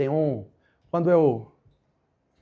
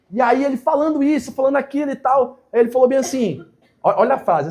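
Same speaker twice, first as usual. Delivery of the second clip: excited retelling; 8 semitones higher, 6 dB louder than usual. Real, not acted.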